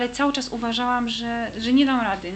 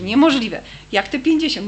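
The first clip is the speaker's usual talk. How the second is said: louder, incredulous exclamation; astonished; 4 semitones higher, 8 dB louder than usual. Retold or imitated. Cleaner recalls her shock at finding the money, louder and astonished. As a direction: burst out in disbelief.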